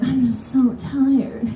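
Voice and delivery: slow, high whiny complaint; whiny tired. Retold or imitated. Seat hog pleading fatigue to the woman, slow whine. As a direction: drag it out pitifully.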